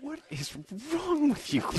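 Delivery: high squeaky mock outrage; escalating comedy rant. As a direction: shriek it in comic disbelief. Mock outrage at ladies' choices.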